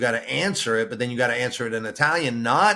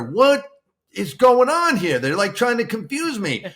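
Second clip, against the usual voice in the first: high, loud bewildered complaint; what is going on here, baffled and exasperated; 9 semitones higher, 4 dB louder than usual. Retold or imitated. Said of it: "I'm saying to her".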